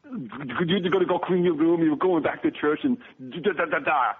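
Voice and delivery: hammed-up tough-guy dad laying down the law; stern dad. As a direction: bark it like an action hero father. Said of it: "I do the Arnold, I do Clint".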